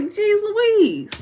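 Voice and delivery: high, loud jeez louise; mock amazement. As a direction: squeal it in playful shock. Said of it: "I was like".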